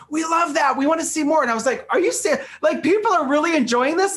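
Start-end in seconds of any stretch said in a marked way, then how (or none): 0.1-1.4 higher, lively retelling of executives' praise; bright eager quote from the buyers; enthusiastic quote. Retold or imitated. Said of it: "executives at Ulta were saying". 1.9-4.2 higher, incredulous question; retelling his own surprise at the praise; shocked disbelief. Retold or imitated. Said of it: "I was actually kinda shocked".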